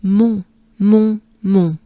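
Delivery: "Mon" with very closed nasal vowel. Shown as a demonstration. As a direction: drill French "Mon", closed up. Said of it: "Take a good breath".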